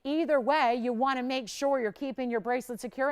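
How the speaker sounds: ordinary talk, nothing marked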